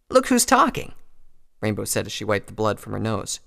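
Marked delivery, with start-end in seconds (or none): 0.1-0.9 very high, louder comeback; scornful retort. A narrator's reading. Rainbow answering Applejack after the fight, scornful. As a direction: throw the blame back, high-pitched.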